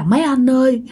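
high, sustained mock-crying wail; acted weepy plea, repeated in a high whine; imitated tearful pleading. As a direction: beg for mother like someone crying, pitched well up. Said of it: "Mà vừa khóc vừa nói vậy".

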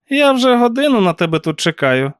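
high-pitched scolding aunt voice; reproachful aunt. A narrator's reading. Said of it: "дорікнула тітка Корд". (високий докірливий голос тітки)

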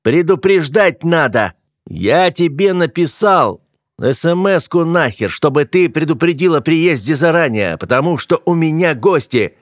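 heated shouting in an argument; angry yelling. A narrator's reading. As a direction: yell back in exasperation. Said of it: "заорал он".

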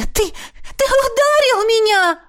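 high, drawn-out wail of outrage; stammer, then cry it out; wailing, high-pitched accusation; hurt accusation. A narrator's reading. Hurt woman accuses lover.

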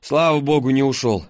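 ordinary delivery, nothing marked

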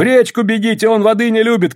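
high, urgent warning call; shouted advice. A narrator's reading. Васька to two fleeing policemen, high urgent shout. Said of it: "крикнул Васька".

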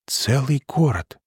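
quiet gasp of awe. Acted. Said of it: "тихо ахнула Анна".

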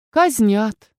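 usual speaking voice

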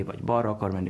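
ordinary talk, nothing marked

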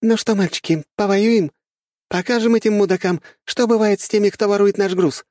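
high, loud female battle call; girl's rallying shout. A narrator's reading. Young woman turning to her soldiers before battle, rallying shout. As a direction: fire up the troops with a shout. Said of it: "выкрикнула девушка".

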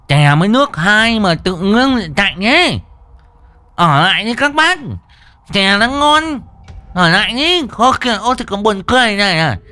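high, loud garbled voice of a stammering tea drinker; tongue-tied lisping vendor. A narrator's reading. Lame vendor begging fleeing stallholders to stay, high garbled lisp. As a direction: call out in a high, slurred speech-impaired voice. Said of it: "cất cái giọng ngọng líu ngọng lô".

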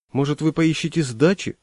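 ordinary delivery, nothing marked

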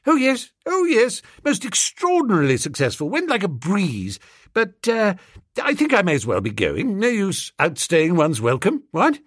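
a hint of a nervous swell faking success; bluffing young gent. Young gentleman bluffing to his valet, lightly voiced and breezy.

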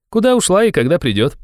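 high, youthful questioning; young man. A narrator's reading. Young man's dialogue voice. (высокий молодой голос, вопросительно)

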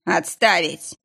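stern barked command; грозный окрик. Acted. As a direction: bark the order like a boss. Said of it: "шеф грозно рявкнул". (грозно рявкнуть)

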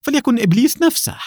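high, fast defiant retort. Acted. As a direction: fling the challenge back. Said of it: "بلهجة تحد".

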